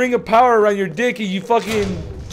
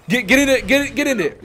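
ordinary talk

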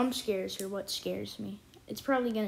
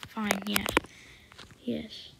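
ordinary delivery

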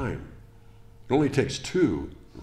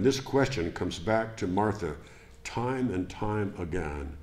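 ordinary delivery

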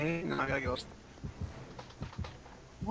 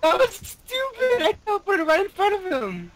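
excited disbelief; 13 semitones higher, 3 dB louder than usual. Real, not acted. Laughing at teleport glitch.

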